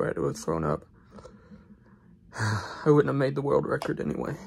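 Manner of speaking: ordinary delivery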